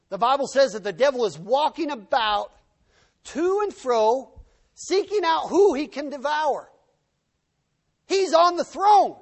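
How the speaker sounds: loud, high, fiery pulpit delivery; full-force preaching. Real, not acted. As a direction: drive it home to the congregation.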